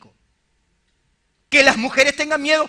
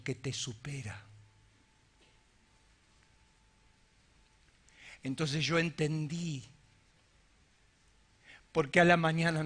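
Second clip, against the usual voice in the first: hushed solemn preaching; 10 semitones lower, 13 dB quieter than usual. Real, not acted. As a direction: confide it gravely to the congregation, barely raised. Confiesa su miedo en voz baja. (prédica íntima, grave y pausada)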